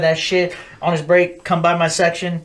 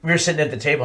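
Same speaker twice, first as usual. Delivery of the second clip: usual speaking voice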